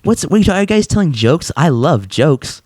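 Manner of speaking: high-pitched mock eager woman; lightly voiced woman, high start, slowing down; woman coworker. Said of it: "a woman comes in and she's like".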